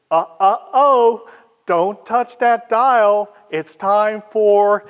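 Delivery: high, loud teasing announcer call, drawn out; playful radio announcer. Retold or imitated. Announcer warning listeners off turning the dial, playful and loud. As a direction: playfully scold the listeners in a big, slow announcer voice. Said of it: "very playfully".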